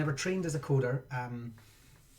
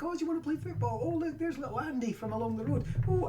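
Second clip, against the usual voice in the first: high, sing-song mock chatter between neighbours; squeaky voices of the people in the park; mocking the neighbours out walking, high and chirpy; mock cheerful neighbours; 12 semitones higher than usual. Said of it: "and it's like".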